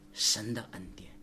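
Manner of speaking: hushed, breathy whisper of the phrase; 神的恩典 whispered, then said aloud. Acted. Pastor repeats phrase softly.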